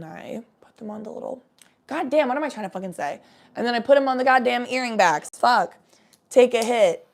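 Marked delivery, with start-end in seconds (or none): none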